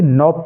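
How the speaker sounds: "नॉब" beginning on n; silent K. Shown as a demonstration. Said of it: "के silent है".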